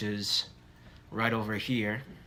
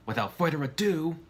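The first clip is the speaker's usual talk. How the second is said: excited; 6 semitones higher than usual. Acted. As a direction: kick it off with energy. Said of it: "sounding excited like he is".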